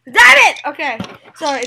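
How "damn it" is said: sudden loud curse; loud outburst. Real, not acted. Player loses a point to opponent, loud outburst. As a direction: burst out cursing at a lost round. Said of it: "that was so loud".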